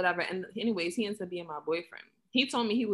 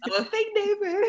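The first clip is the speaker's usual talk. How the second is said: laughing mishearing; 13 semitones higher, 5 dB louder than usual. Real, not acted.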